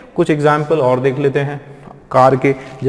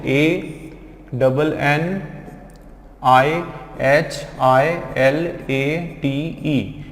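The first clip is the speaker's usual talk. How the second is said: letters spelled out slowly, one by one; slow spelling dictation; much slower than usual. Shown as a demonstration. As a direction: spell each letter deliberately for the class. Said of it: "spelling out letters".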